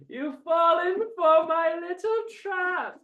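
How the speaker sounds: high, slow gloating villain; high mocking villain gloat. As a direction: savor the trap like a cackling villain. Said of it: "I love how I just get to be full villain character".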